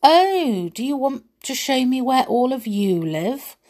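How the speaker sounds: light up with sweet wonder; pleasantly surprised. Acted.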